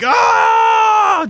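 high joking yell of pain; playful yelp. Pretend. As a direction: yelp like the shot hurt. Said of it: "walk out of the room like".

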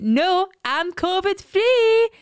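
high, very slow proud announcement; nana's reply. Retold or imitated. Mother mimicking nana on FaceTime, high and slow. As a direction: stretch it out like a pleased granny.

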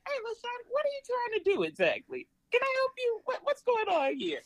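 put-on a bright, high Georgia country voice for a small child. Shown as a demonstration. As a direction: sweet-talk a toddler in a Georgia accent. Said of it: "I say".

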